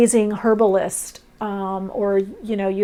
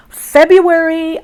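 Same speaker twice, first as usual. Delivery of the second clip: "February" much higher, louder and slow; excited exclamation; 8 semitones higher, 10 dB louder, slower than usual. Real, not acted. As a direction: announce the month like big news. Excited reveal of the month.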